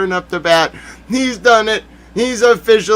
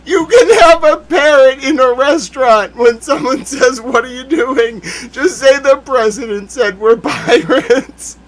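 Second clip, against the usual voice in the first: high, giddy, laughing through it; cracking up mid-sentence; 6 semitones higher, 3 dB louder than usual. Real, not acted. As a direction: barely hold in the laughter. Cracking up at own pirate plan.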